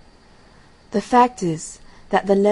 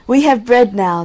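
put-on higher and louder, delighted children; hungry children cheering over bread; joyful cry; 4 semitones higher, 8 dB louder than usual. A narrator's reading. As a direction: call it out with glee. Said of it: "they cried".

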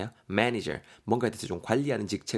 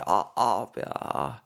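pained groan; much slower than usual. Acted. Character's stomach hurts.

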